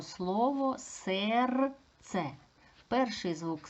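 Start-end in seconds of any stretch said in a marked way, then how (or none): 0.8-2.3 СЕРЦЕ stretched into slow syllables; careful, slow teacher demonstration; sounded out. Shown as a demonstration. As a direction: draw out each part of СЕРЦЕ. Teacher sounds out word for analysis.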